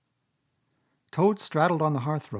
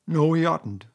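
louder interrupting retort; mouth-full shout; 4 semitones higher, 6 dB louder than usual. A narrator's reading. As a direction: cut in loudly. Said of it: "shouted the Mole with his mouth full".

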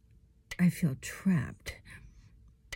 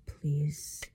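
begging whisper; 6 dB quieter, much slower than usual. Acted. Begging under torment.

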